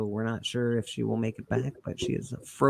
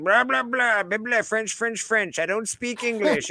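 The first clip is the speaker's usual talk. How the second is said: mocking cab driver; 7 semitones higher, slower than usual. Man mocking a Montreal cab driver's dodge, high mock babble. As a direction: parody the driver's gibberish, pitched up. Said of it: "He said".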